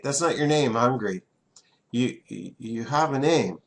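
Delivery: ordinary delivery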